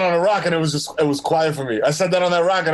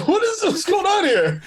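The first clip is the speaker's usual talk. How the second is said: incredulous shout; 11 semitones higher, slower than usual. Acted.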